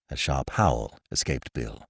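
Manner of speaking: ordinary delivery, nothing marked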